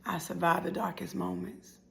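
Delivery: slow, whispery poetic delivery; soft, solemn whisper; hushed refrain. Acted. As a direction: whisper it slowly, with pauses.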